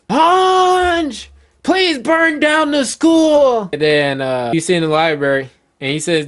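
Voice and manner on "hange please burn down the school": high, drawn-out pleading yell; desperate scream. Acted. Elder Gerald screams a plea to burn the school, desperate scream. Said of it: "Elder Gerald screams".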